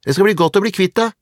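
put-on high, loud girl's taunt; acted childish parting shot; calling after him. A narrator's reading. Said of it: "ropte Åse etter ham".

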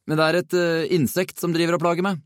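high-pitched, cheeky excuse; feigned innocence. A narrator's reading. Nick blames his teacher's pinch on an insect, mock-innocent. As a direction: explain innocently, with a small hesitation. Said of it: "så veldig uskyldig ut".

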